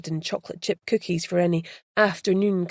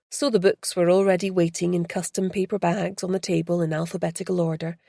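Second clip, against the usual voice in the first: ordinary delivery, nothing marked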